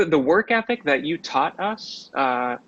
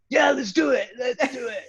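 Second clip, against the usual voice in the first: eager shout; 9 semitones higher than usual. Retold or imitated. Young actor hurt but yelling eagerly to his teacher. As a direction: pump yourself up, shouting. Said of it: "I was like".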